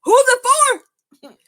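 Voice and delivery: high, loud demanding question; mock sharp challenge. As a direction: fire it back at him. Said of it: "And she's like".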